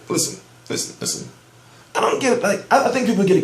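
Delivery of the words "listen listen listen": near whisper, fading away; hushed repeat. Real, not acted. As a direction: say it softer each time. Trailing repeated "listen.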